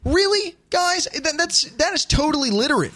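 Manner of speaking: high-pitched retold exasperation; incredulous scolding. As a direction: call them out in a high, disbelieving voice. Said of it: "But I'm going".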